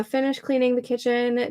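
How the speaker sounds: ordinary talk, nothing marked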